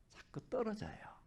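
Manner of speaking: whispered aside. Real, not acted. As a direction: drop to a whisper as if sharing a secret. 배터리 농담 뒤 속삭임. (속삭이듯)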